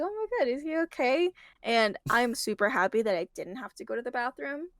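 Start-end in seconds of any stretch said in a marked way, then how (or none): 0.0-1.3 retold worry, pitched up; lightly acted alarm in a higher voice; mock concern. Retelling reaction to the motionless moviegoer, mock concern.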